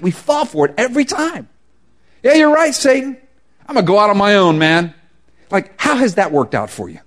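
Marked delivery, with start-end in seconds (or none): 2.2-4.9 mock dumb believer. Mocking foolish believer's reply.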